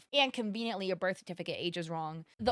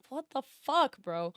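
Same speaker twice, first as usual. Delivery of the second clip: shocked outburst; 6 semitones higher, slower than usual. Real, not acted. Shocked reaction to racist post.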